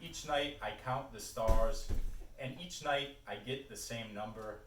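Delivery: projected recital in short phrases with weighted pauses; poetry reading. Acted. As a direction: start each line lifted, let it fall away. Reads a poem aloud.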